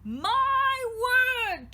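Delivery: high, loud alarmed cry; horrified outburst at the screams. A narrator's reading. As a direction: shriek it in shock. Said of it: "Tristan cried".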